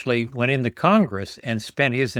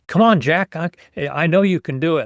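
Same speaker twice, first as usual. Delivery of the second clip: higher, louder pleading encouragement; excited audience; 5 semitones higher, 4 dB louder than usual. Acted. Speaker imitates movie audience cheering on Jack and Rose, excited. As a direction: act out an excited crowd urging them on. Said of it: "I've got people crying and excited".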